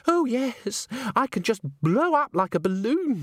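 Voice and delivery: put-on high, girlish, swooping voice; airy prattle. A narrator's reading. Christine explains her tiny breakfast to Agnes, airy prattle.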